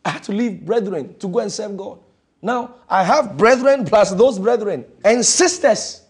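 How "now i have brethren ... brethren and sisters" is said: loud, slow, drawn-out proclamation; slow emphatic preaching. Acted. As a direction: stretch each word to the crowd. Preaching at full force.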